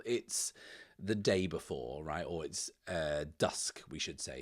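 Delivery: ordinary delivery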